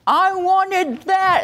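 put-on high, slow whining child voice; lightly voiced pouting child; whiny child. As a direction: drag it out like a sulky little kid. Voicing an upset child.

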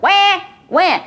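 high, loud mock crying wah; imitating a crying baby's high wah; mock baby wail.

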